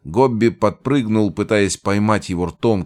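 plain reading voice

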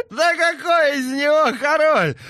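high, loud words through mad laughter; laughing mockery. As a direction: laugh the scorn out. Laughing madman mocks king.

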